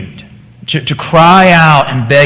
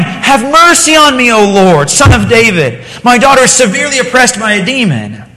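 higher, desperate cry for mercy; crying plea; 6 semitones higher than usual. Acted. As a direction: cry out to the Lord for your daughter. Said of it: "was crying".

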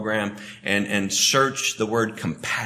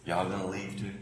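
much softer Southern "Y'all gonna leave too?"; hushed Southern drawl; 2 semitones lower, 9 dB quieter, faster than usual. Retold or imitated. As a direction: let it fall almost to a murmur, Southern style. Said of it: "in my Southern way".

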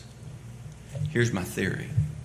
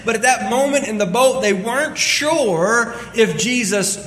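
loud, fast-building sermon delivery; preaching at full force; 11 semitones higher, 6 dB louder than usual. Acted. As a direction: drive the point home to the congregation. Preaching about trusting the Lord.

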